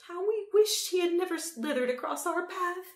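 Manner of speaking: high, loud unhinged Empress; mad old Empress. A narrator's reading. Mad Empress laments the shadow.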